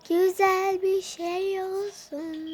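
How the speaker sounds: slow, pleading put-on voice; pleading wish. Vlogger begging the unboxing for something nice, slow pleading. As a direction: beg the box for something nice, slowly.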